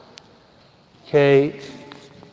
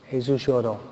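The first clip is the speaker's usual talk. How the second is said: más grave y mucho más bajo; repetición suave; 4 semitones lower, 8 dB quieter than usual. Shown as a demonstration. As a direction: deja caer "Jesús lloró" casi en un susurro sonoro. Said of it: "Y dice la Biblia que Jesús lloró". (el predicador repite el versículo, suave y grave)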